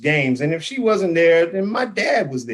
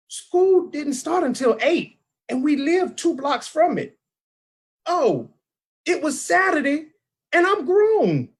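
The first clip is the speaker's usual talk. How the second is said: high-pitched, exasperated protest; comic exasperation; 10 semitones higher than usual. Acted. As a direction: protest in a much higher voice, drawing it out. Recounting absurd early school wake-up.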